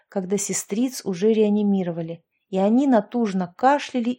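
ordinary delivery, nothing marked

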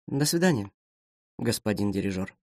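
low, soft, flat-toned parting; cold farewell. Acted. Expressionless officer coldly bidding farewell. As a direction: coldly close the matter.

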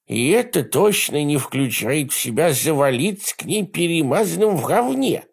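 high, lisping, prissy scolding; effeminate lisp. Acted. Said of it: "женоподобный шепелявый голос".

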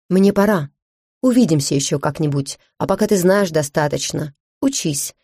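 ordinary delivery, nothing marked

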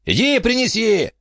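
high, sneering thug voice; mock the crazy old lady. Bandit orders.

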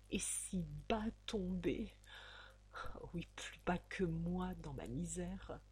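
whispered in despair. A narrator's reading. A heartbroken girl whispering to herself. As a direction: breathe the words out bitterly.

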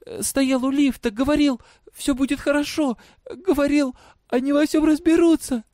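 put-on high, halting, agitated girl; flustered stammering. A narrator's reading. Girl confronting her father, flustered and faltering. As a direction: stumble over the words in a high voice. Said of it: "говорила суетливо, запиналась".

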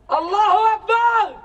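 high, loud cry of Allahu Akbar; a rally cry over the crowd; ecstatic cry. Acted. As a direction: throw it out high and full. Chanting cry in protest poem.

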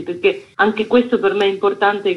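ordinary delivery

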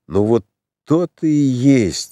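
put-on slurring as if drunk; slurred drunk speech. A narrator's reading. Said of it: "заплетающимся языком".

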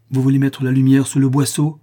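indignant madman raising his voice; angry outcry. A narrator's reading. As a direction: cry out with clenched fists. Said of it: "s'écria-t-il en fermant les poings".

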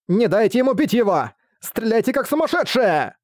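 high-pitched battle command; exclaiming. A narrator's reading. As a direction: cry out orders over the fighting. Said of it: "воскликнула она".

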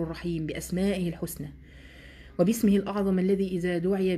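دعاء بصوت خافت; soft prayer. Real, not acted. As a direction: pray gently, as if for the students. Teacher breaks into supplication.

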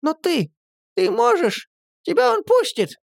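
very high joyful squeak. A narrator's reading. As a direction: squeak with sudden hope. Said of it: "радостно пискнула Настя".

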